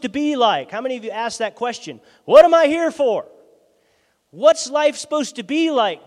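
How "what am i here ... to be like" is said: high and loud, crying out to God; a desperate, pleading call; anguished plea. Retold or imitated. Preacher voicing a searching believer asking God, loud plea.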